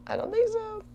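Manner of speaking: very high, squeaky mocking voice; mocking squeak. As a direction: squeak it out like a smug put-down. Mocking answer for Yvonne.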